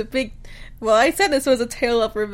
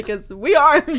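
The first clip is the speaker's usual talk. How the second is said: laughing joke line; 2 semitones higher, 8 dB louder than usual. Pretend. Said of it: "I thought you were gonna say".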